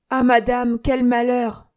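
loud, halting cry of dismay; broken voice. Acted. Concierge to madame, crying out in broken voice. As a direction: gasp it out, voice breaking. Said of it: "disait-il d'une voix entrecoupée".

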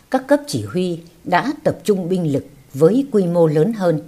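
ordinary delivery, nothing marked

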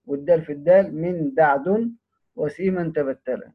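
ordinary delivery

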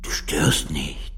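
whisper. Acted. Gentle nighttime reply.

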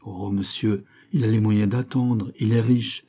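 plain reading voice

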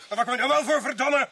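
fed up with being disturbed at night; irritated outburst. Acted. Suspect snapping at the police at his door, irritated.

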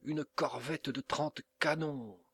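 alarmed exclamation. A narrator's reading. Captain spotting the enemy warship, alarmed cry.

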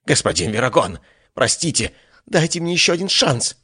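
high desperate begging; pleading. Acted. Apostle begs on knees.

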